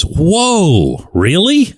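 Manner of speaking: retold amazement, high rising whoa; amazed whoa. Reporter retells quarterback seeing the photo, mock amazed.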